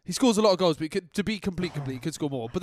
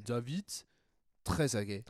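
French accent; 6 semitones lower, 9 dB quieter than usual. Shown as a demonstration. Host tries the name again in a French accent. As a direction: slide into French for "Trezeguet". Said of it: "a, a French accent".

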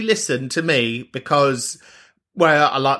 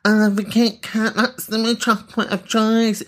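mocking whiny voice; 5 semitones higher than usual. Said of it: "sat at home being like".

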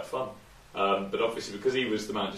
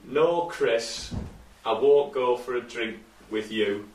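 put-on slow, louder Yorkshire accent insult; 2 semitones higher, 4 dB louder, much slower than usual. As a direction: deliver it broad Yorkshire. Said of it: "give us your best Yorkshire accent".

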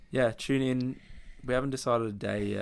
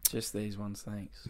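mumbled checkout line; 4 semitones lower, 13 dB quieter, slower than usual. Pretend. Mumbled minimal checkout phrase.

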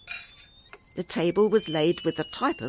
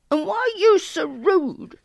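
put-on very high, louder indignant child; shouting girl; 13 semitones higher, 5 dB louder than usual. A narrator's reading. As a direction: challenge the rude boy in a high voice. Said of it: "Dilys shouted".

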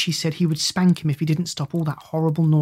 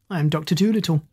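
ordinary delivery, nothing marked

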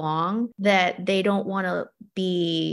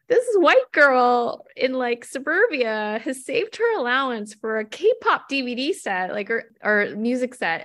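amazed; 5 semitones higher than usual. Real, not acted. Guest marvels to the host about the girl's K-pop set, higher and amazed. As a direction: savour how unlikely it is.

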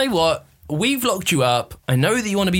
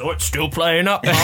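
mock voice; 2 semitones higher than usual. Mock reply as Connor.

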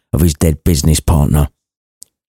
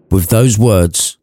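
ordinary delivery, nothing marked

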